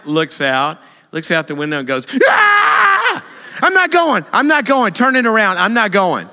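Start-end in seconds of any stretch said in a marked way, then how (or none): 2.2-3.2 sudden high shrieking scream, imitating the fiancée; panicked scream. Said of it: "Miss Calm is now freaking out". 3.6-6.3 high, loud panicked pleading, imitating the fiancée; panicked refusal. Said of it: "Miss Calm is now freaking out".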